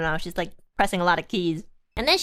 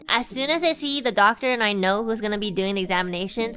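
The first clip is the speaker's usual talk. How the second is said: ordinary talk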